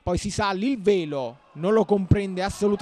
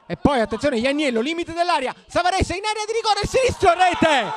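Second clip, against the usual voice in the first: rising, faster, building to a shout; breathless italian goal commentary; 8 semitones higher, 4 dB louder than usual. Real, not acted.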